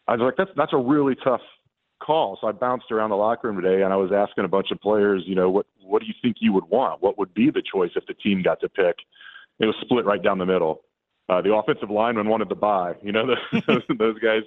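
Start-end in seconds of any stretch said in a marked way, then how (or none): none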